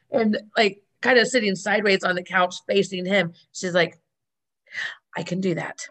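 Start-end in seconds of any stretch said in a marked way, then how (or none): 5.1-5.8 mock serious, low and quiet. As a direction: say it dead serious. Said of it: "she gets all serious".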